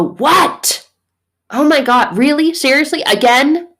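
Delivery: high, loud annoyed questions; exasperated outburst. Retold or imitated. God as an annoyed parent called yet again, exasperated. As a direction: react in hammed-up disbelief at being called. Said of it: "You don't go".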